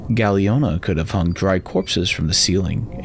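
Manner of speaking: ordinary delivery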